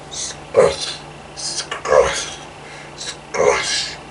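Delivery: soft whispered squishing. Pretend. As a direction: breathe out the squelch of wet leaves. Footsteps in wet leaves.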